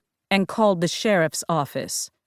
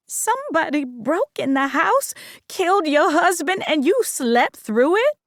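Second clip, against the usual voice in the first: higher-pitched incredulous mother; Mama's voice; 9 semitones higher than usual. A narrator's reading. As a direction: voice the mother, disbelieving. Mama voiced in story.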